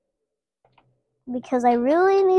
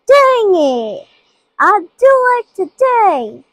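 excited; 7 semitones higher, 6 dB louder, slower than usual. Real, not acted. Frustrated exclamation.

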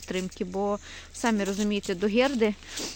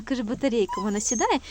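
ordinary delivery

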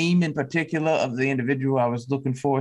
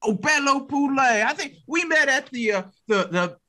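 higher lightly voiced Louisiana drawl; 8 semitones higher than usual. Bella tells Stan where they met, light Louisiana drawl. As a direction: drawl it out sweetly. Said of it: "my Louisiana draw".

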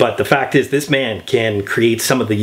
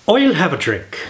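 mock Irish teacher showing the line; a light Irish lilt on "I'll have a drink"; dialect coach's Irish accent; 4 semitones higher than usual. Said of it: "can you imagine the dialect coach".

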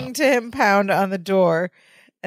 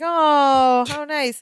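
high, cooing mock admiration; admiring guests; 6 semitones higher, much slower than usual. Party guests admire a scarf, high imitation. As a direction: coo it, pitched up. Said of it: "everyone's like".

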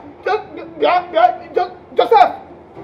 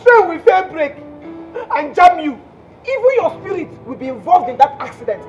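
venomous cursing; 3 dB louder than usual. Acted. Jilted caller cursing Joseph abroad, loud venomous outbursts. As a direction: hurl each curse separately, with pauses between. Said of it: "why are you spitting so much venom".

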